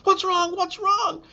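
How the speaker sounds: high, worried wife voice; panicked wife. Retold or imitated. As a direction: rush in alarmed, asking what happened. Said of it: "she ran into the bathroom".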